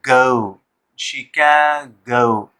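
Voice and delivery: "शिखे गो" very slow, broken into syllables. Shown as a demonstration. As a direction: sound out "शिखे गो" in slow parts. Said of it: "फिर यहाँ एक dot मिलेगा".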